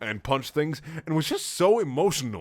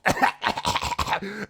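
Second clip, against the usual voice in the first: breathy hammed-up cough; fake cough; 10 semitones higher than usual. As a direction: cough like a sick kid. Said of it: "[name] coughed".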